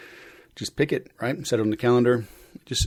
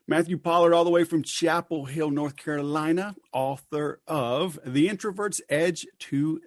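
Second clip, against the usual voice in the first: raised, ringing announcer voice; announcer intro; 5 semitones higher than usual. Acted. Host announcing his guest on the podcast, announcer voice. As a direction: sell the guest's name like a show host.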